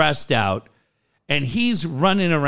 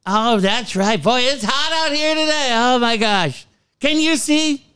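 higher, sing-song mock of a clueless parent; breezy mock small talk, pitched up; mocking oblivious parent; 7 semitones higher than usual. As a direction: play the oblivious parent chirping away.

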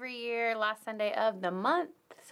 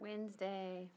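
barely audible, half asleep; sleepy mumble; 14 dB quieter, much slower than usual. Real, not acted. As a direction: say it like you just woke up. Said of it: "you gotta wake up. You gotta be loud".

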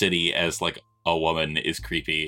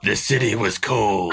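hard-boiled pulp narration; noir detective. Retold or imitated. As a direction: intone it like a moody pulp narrator. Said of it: "go full Frank Miller and just put, like, a block of text".

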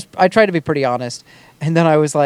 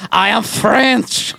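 higher, drawn-out hammed-up French; cartoon French accent; 7 semitones higher, much slower than usual. Shown as a demonstration. Showing the second French accent, like a cartoon chef, hammed-up French. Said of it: "the other one was like".

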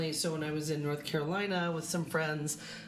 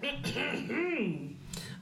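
real throat clearing; 10 semitones higher, 3 dB quieter than usual. Real, not acted. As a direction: clears throat after choking. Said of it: "choking on a margarita seed".

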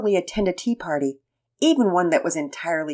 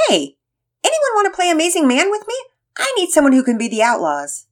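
put-on eager boy voice; 11 semitones higher, 5 dB louder than usual. Pearson calling to sisters.

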